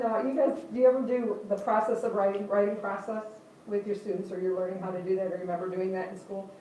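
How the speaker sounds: ordinary talk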